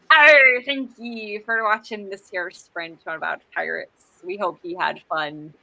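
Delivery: playful pirate sign-off. Pretend. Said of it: "do it in our pirate voices".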